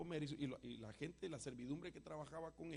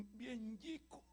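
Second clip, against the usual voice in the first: put-on high, babyish little voice; father imitates his small son's reply; toddler voice; 7 semitones higher, much slower than usual. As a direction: play a tiny child answering sweetly.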